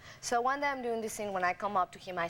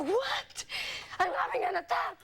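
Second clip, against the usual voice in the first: mock panicked boyfriend; 6 semitones higher than usual. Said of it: "And I go".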